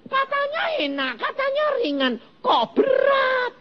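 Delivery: high, mocking whine of a disappointed believer; a high, incredulous mock grumble; mocking complaint. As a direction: squeak out the complaint in mock dismay.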